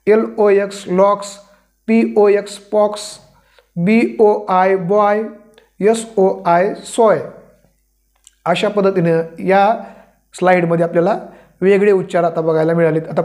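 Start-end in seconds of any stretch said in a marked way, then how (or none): none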